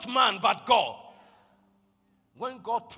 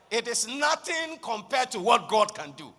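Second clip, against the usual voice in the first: ordinary talk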